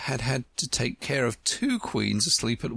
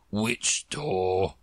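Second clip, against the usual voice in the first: slow drawl; 4 semitones lower than usual. Acted. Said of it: "the slow drawl".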